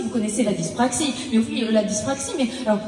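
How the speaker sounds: usual speaking voice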